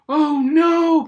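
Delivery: very high, loud little cry; a frightened piglet's wail; high shrieking "oh no"; alarmed cry. Acted. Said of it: "Peppa cried".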